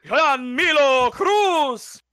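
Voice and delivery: slow, drawn-out name call; lucha libre announcer. Acted. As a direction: stretch the name for the crowd. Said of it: "come up with new intros".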